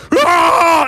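high, loud mock roar of rage; rage monster roar. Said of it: "And he's like".

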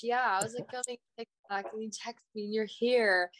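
ordinary delivery

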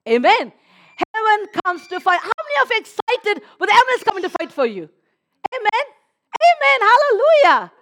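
loud, high, rousing preacher; full-force preaching. Acted. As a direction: build it up like a revival preacher. Rouses the congregation.

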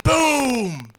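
high, loud boom of a rifle; cartoon gunshot yelled out; shouted gunshot boom. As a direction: bark out the gunshot. Joke narration, gunshot sound.

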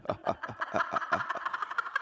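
high breathy eerie cackle; a ghostly, unhinged laugh out of nowhere; creepy laugh. Retold or imitated. Ghost woman on the lift floor starting to laugh, eerie cackle. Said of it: "उसने हंसना शुरू कर दिया".

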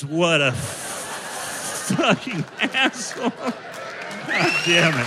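slow, dragged-out disgust at himself; slow, disgusted self-insult; self-mocking drawl. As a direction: stretch it out like a grumbling heckler.

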